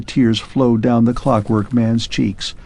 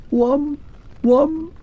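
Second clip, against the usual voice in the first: much higher, slow, far-off echoing call; distant echo. Acted. Vanished clockwork man's last echo to Arthur, distant high call. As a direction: sound from far away.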